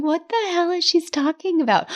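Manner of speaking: high, bewildered mock exclamation; baffled reaction. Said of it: "sitting there going".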